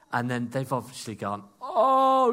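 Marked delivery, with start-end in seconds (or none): 1.6-2.3 sudden realisation. Retold or imitated. Disciples realise Jesus knows.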